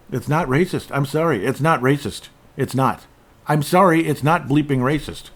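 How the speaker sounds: high-pitched, sing-song mock protest; put-on protesting voice. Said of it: "why I'm talking like that".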